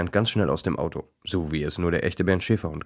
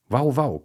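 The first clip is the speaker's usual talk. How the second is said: a duck trying to bark, high quacky woof; quacking woof; 7 semitones higher, 4 dB louder than usual. A narrator's reading. Duckling imitating the dogs' bark, high and quacky. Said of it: "quakt Peggy".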